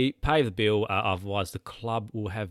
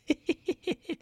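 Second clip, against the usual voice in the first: squealing laugh; 3 dB quieter than usual. Real, not acted. Own laugh, high squeal.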